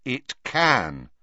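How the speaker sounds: clearly. A narrator's reading. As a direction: state it clearly and firmly. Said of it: "said Harry clearly".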